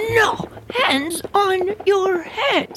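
put-on high, slow barked order with a strong accent; teacher yelling. Riding teacher orders the girl, yelling. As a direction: bark it at the kid like a drill sergeant.

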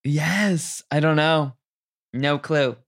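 ordinary delivery

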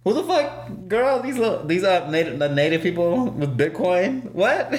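high, disbelieving outburst; incredulous. Real, not acted. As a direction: squeal it in disbelief. Incredulous rant about Bitcoin.

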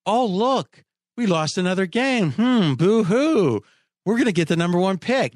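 high mocking sob; hammed-up boo-hoo, slowing on it; fake crying. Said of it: "he said that".

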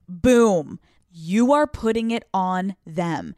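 ordinary delivery

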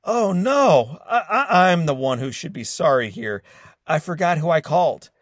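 mock-apologetic, high and loud with a laugh; sarcastic. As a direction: drip with fake sorrow. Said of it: "replied sarcastically".

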